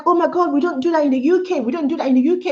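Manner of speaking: high, fast, startled voice; shocked. Retold or imitated. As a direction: act amazed that he drives on the phone. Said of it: "And I was like".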